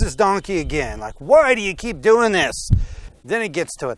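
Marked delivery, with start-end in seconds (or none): 1.2-2.7 exasperated scolding. Acted. Balaam whipping donkey, exasperated.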